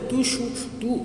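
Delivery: high-pitched questioning goddess; forest goddess. Acted. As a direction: act a goddess asking after a crying tree. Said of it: "વન દેવીએ કહ્યું કે".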